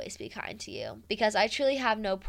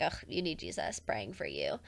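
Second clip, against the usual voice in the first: muttered petty dismissal, much softer; 4 semitones lower, 9 dB quieter than usual. Retold or imitated. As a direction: imitate petty piety, tossed off low and quiet. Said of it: "as a petty way".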